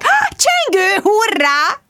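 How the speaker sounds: very high, drawn-out delight; excited squeal. Acted. Bridget thrills at Mark's doorbell, excited.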